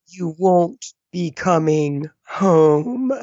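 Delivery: slow, ominous trailer narrator; horror trailer voice. Retold or imitated. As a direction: drag it out like a creepy trailer voice. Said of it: "The narrator is great".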